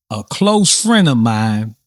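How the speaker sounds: ordinary delivery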